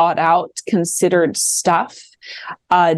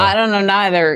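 mock Southern reply; faster than usual. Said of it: "my Southern accent".

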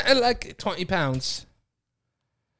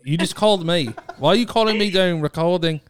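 insistent put-on British accent. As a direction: accuse in casual British slang and accent. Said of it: "you have to do British accent".

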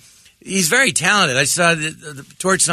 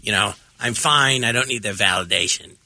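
lightly voiced Fierstein reassuring himself; Harvey Fierstein impression; 7 semitones lower than usual. Comic retelling the sketch opening, low impression. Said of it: "I start off".

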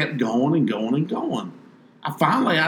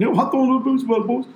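1 mocking boast; 12 semitones higher, 3 dB louder, faster than usual.

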